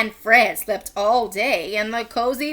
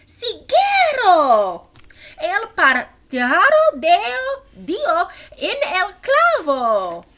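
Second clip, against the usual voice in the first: higher, louder excited call; excited exclamation; 8 semitones higher, 6 dB louder, slower than usual. Acted. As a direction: cheer it out.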